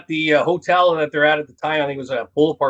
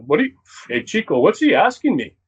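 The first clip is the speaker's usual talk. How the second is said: put-on Razor Ramon accent; 4 semitones higher than usual. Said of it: "Razor Ramon character play".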